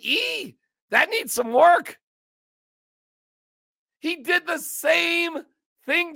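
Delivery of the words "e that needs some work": mock snooty clerk. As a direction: squeal out the put-down. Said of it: "he went".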